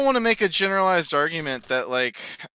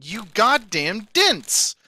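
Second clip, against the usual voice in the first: ordinary talk, nothing marked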